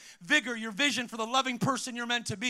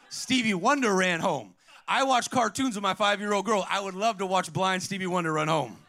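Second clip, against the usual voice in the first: ordinary talk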